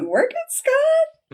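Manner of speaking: high, slow sing-song cheer. Pretend. Cheering Scott on from the couch, slow and high. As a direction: egg him on like a hype friend.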